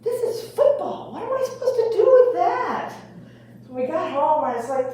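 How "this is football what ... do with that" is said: high, bewildered protest; throwing up his hands in disbelief; exasperated coach. Retold or imitated. Coach baffled by the boy's answer, high and exasperated. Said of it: "The coach goes".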